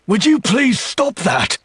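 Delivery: high-pitched pleading yell; exasperated cry. Acted. Demon to screaming woman, exasperated high yell. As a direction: cry out high over the noise. Flinching from her scream, begs her stop.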